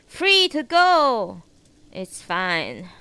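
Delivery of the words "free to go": loud, high, slow mock brush-off; sassy. Real, not acted. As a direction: shoo them off with attitude. Sassy dismissal of viewers.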